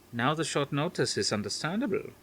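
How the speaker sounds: a hint of a Dutch grandmother; faint Dutch accent. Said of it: "her faint Dutch accent".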